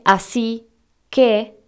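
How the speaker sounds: "así", a pause, then "que"; two separate words. Shown as a demonstration. Showing listeners that it is not written todo junto, split with a pause. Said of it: "en vez de".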